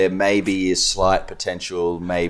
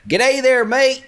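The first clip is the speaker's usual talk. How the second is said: hammed-up American accent, high and loud; 5 dB louder, slower than usual. Shown as a demonstration. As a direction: belt out a mock American greeting. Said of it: "Let's hear the American accent".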